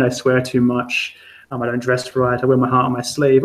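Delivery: usual speaking voice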